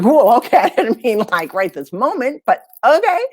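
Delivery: startled and amused disbelief; surprised laugh. Retold or imitated. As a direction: caught off guard, amused. Surprised she fired client.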